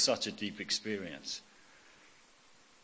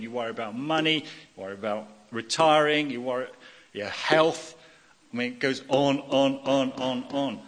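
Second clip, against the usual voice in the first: louder, pressing on through the list of worries; building preaching; 8 dB louder than usual. Acted. Preacher to his listeners, listing worries, voice building louder. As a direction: pile up each worry with rising force.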